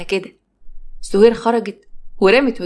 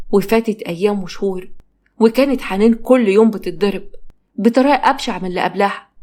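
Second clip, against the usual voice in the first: ordinary delivery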